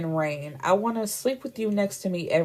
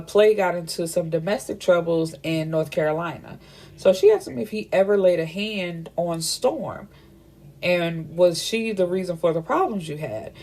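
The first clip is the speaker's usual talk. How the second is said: usual speaking voice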